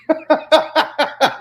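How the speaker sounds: big amused laugh. Real, not acted. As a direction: burst of high laughter. Laughing at ranger story.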